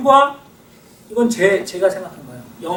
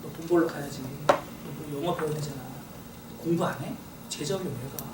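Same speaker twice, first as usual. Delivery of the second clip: lower, softer scolding commands; stern inner voice; 7 semitones lower, 13 dB quieter than usual. Acted. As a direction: scold yourself quietly like a strict parent. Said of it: "이성적으로 생각하라고".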